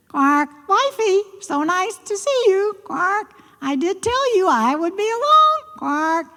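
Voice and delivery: high croaking frog voice. Acted. Frog character voice.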